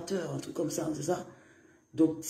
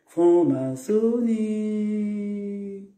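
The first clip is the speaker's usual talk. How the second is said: mock ad tagline; 2 semitones higher, much slower than usual. Mock ad slogan.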